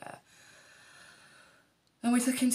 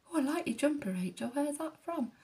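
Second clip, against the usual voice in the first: chirpy midwife; 10 semitones higher than usual. Retold or imitated. Midwives quoted chatting.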